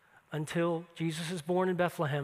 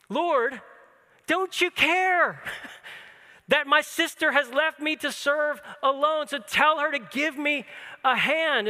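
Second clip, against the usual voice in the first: frustrated complaint; 7 semitones higher, 5 dB louder, slower than usual. Retold or imitated. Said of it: "fuming and steaming toward Mary".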